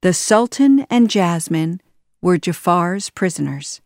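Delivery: ordinary delivery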